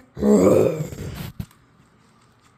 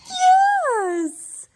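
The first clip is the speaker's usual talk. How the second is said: much higher delighted yes; excited praise. Real, not acted. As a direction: cheer the right answer. Praising toddler.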